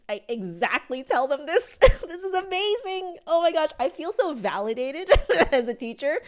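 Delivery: high, loud, through laughter; laughing delight. Real, not acted. Teacher tells viewers she feels validated, laughing high and loud. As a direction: laugh with relief at being proven right.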